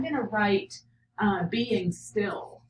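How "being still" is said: ordinary talk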